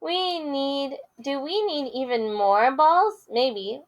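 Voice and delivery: lively picture-book read-aloud with character voices; reading to children. Acted. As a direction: read it big for the kids.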